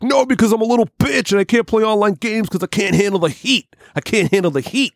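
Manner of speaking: high whiny self-pitying voice; mocking Sam. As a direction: whine like a little coward. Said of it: "He's like".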